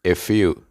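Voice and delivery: "If you" run together as one word; words joined. Shown as a demonstration. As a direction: link the F of "If" into "you". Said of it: "se unen y se pronuncia". ("If you" unido como una sola palabra)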